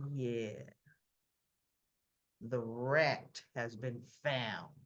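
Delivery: low, slow and soft menace; hint of a cold gangster; menacing mob boss. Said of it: "Sonny answering Dex".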